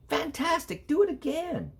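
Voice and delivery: high excited cry of praise; shouted. Acted. Princess cheering the dragon, high excited shout. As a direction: cheer him on to go again. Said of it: "Elizabeth shouted".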